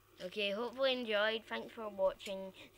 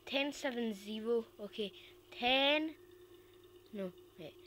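ordinary delivery

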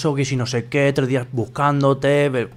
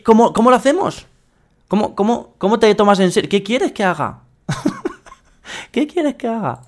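exasperated mock plea; 7 semitones higher than usual. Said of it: "Y el otro".

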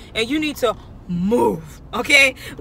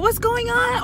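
mock gentle high server voice; 12 semitones higher, slower than usual. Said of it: "now you wanna talk all gently".

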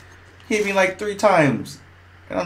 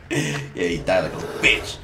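ordinary talk, nothing marked

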